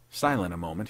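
usual speaking voice

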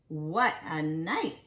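ordinary delivery, nothing marked

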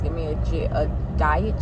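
normal talking voice

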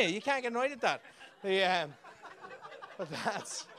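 ordinary talk

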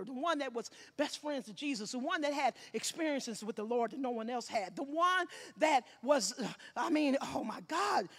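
ordinary delivery

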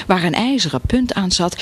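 plain reading voice

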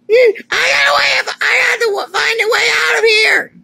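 very high, loud frantic shout; shrieking in panic; desperate yelling. Acted. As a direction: scream it, desperate to escape. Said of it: "I'm sorry I yell at you".

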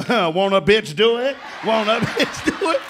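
a woman taunting the man who insulted her; sassy flaunting. Retold or imitated. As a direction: rub it in his face.

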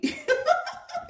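a burst of high-pitched real laughter. Real, not acted. Laughing at own quote.

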